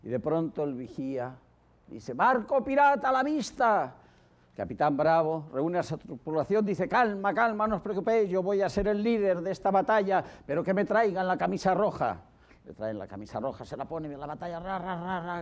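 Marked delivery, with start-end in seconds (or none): 2.1-4.0 high, loud call from the mast; lookout's cry. Acted. Lookout shouts ship sighted. 6.9-12.2 higher, louder captain's address; rally the crew with authority; commanding captain. Acted.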